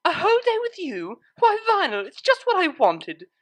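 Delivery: high, loud sarcastic gush; mock excitement. A narrator's reading. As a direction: overdo the delight, clearly faking it. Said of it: "in mock excitement".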